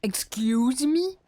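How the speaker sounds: drawn-out high indignant excuse me; mock offended. Streamer scolding chat, mock offended. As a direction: play it scandalized at chat.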